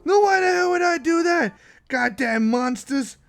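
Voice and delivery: high, loud outraged yell; impression gone overboard. Player refusing to clear out abominations, outraged mock yell. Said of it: "This isn't even an impression anymore".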